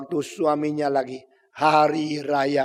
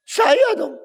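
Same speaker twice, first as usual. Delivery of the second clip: smug boast; 6 dB louder than usual. Acted. Herod boasting he is greater.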